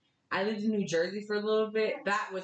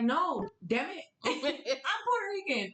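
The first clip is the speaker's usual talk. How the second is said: drawn-out, higher protest with a laugh; mock-exasperated comeback, pitched up; exasperated retort; 9 semitones higher than usual. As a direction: protest it slowly, half laughing. Retorts to people mislabeling her.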